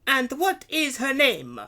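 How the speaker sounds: high, slow, loud question; demanding stallholder. A narrator's reading. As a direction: question them loudly and slowly. Stallholder interrogates the children.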